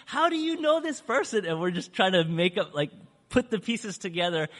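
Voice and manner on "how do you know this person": the speaker questioning Joe, amazed and high; astonished question. Retold or imitated. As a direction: squeal it in delighted shock.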